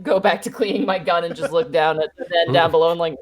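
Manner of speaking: ordinary talk, nothing marked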